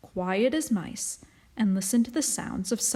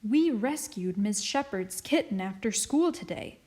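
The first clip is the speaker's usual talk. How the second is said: ordinary delivery, nothing marked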